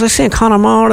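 very high-pitched North Side Dublin delivery man; comic high north Dublin voice; north side Dublin accent. Acted. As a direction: squeal it up high in broad Dublin. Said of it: "a North Side dub".

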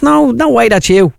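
very high-pitched North Side Dublin delivery man; comic high north Dublin voice; north side Dublin accent. Acted. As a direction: squeal it up high in broad Dublin. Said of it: "a North Side dub".

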